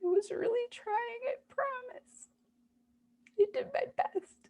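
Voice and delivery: high, breaking voice through real sobs; real crying. Real, not acted. A frightened inner part pleading to be believed, crying. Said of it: "this part that's at the surface right now and just expressing this fear".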